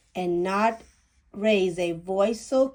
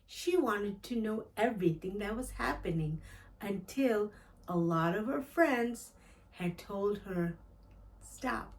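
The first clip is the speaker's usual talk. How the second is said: quiet retelling; 10 dB quieter than usual. Real, not acted. A reader softly recapping the girl's story afterwards, hushed. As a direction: murmur the story back to yourself.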